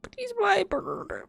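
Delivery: sobbing over a beloved pet; high weepy whimper; crying. Real, not acted. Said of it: "Yes, I was".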